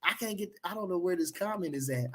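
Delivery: normal talking voice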